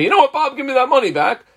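mock call after a messenger; calling after Bob. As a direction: shout after Bob to come back.

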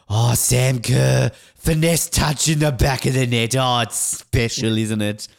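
slow, loud, drawn-out mock commentator call; mock Bruce commentary. Mocking how Bruce would call a women's football goal, slow and hammed-up. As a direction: savour each word like a gushing TV caller. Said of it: "Channel seven might have the Women's World Cup".

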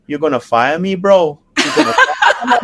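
imitating Jack's accent with a laugh. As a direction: plead in Jack's accent, cracking up. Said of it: "Your accent, I can't even deal with it".

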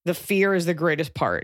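normal talking voice

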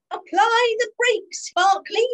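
high, loud and slow command; urgent order. Acted. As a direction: bark the order to the driver. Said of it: "he ordered".

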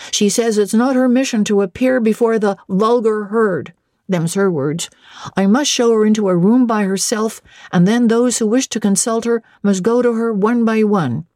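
high, insistent servant report; footman reporting. A narrator's reading. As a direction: relay the message as a footman.

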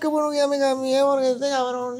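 high voice collapsing into laughter; laughing quote. Retold or imitated. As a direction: quote it while cracking up, pitch way up. Quoting joke while cracking up.

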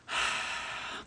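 long, soft sigh; real sigh. Real, not acted. Speaker pausing after a political rant, long sigh. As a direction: let out a long breath.

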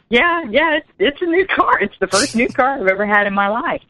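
high, lightly excited retelling; rueful excitement. Retold or imitated. [name] tells the driver about his first new car, high and lightly excited. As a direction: lift it up high with mild glee.

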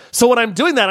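usual speaking voice

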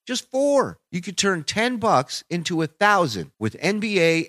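brisk upbeat sponsor read. Acted. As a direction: sell the app like a podcast ad. Reads sponsor ad.

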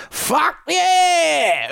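sudden high shouted burst of joy; gold medal yell. Retold or imitated. Swimmer yelling at the interviewer after winning gold, joyful shout. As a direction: blurt it out like a thrilled winner. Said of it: "and she goes".

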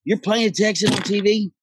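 mock Texan disbelief at an Irish actor; incredulous Texan. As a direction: play the stunned Texan host. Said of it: "He's like".

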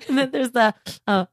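ordinary talk, nothing marked

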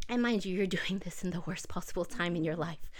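a breathy whisper with a laugh in it; whispering the aside to the new mom. Real, not acted. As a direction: lean in and whisper it as a side note.

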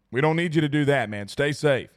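usual speaking voice

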